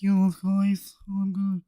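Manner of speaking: light mock of a raspy streamer voice; raspy voice impression. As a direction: toss off a quick raspy-voiced greeting, half-joking. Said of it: "the low raspiness of the voice".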